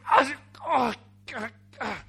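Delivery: loud groaning cry, then dropping; mock anguish. Brother groans while bowing seven times, mock anguish. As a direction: wail like a man pleading for mercy. Acts out Jacob bowing in fear.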